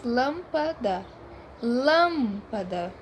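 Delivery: lâmpada slowly, LÂM leaned on; stress on LÂM. Shown as a demonstration. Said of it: "É a terceira de trás pra frente". (lâmpada devagar, forte no LÂM)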